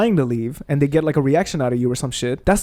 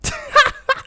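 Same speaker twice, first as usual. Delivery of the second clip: a sudden high-pitched laugh; burst of laughter. Real, not acted. Ranting about ex in psychology, high incredulous laugh.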